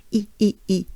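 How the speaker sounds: E as a smiling ee vowel; short, repeated smiling E; banana smile. Shown as a demonstration. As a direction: spread the lips wide for each E. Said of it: "banana smile, the E sound".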